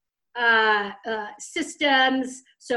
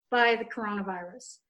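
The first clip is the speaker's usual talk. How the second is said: usual speaking voice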